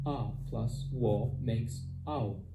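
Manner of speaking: A plus yo makes I, drawn out slowly with I gliding; teacher modelling slowly for learners, clear and drawn out; slow diphthong demo. Shown as a demonstration. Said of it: "Diphthongs are an important part of Esperanto pronunciation".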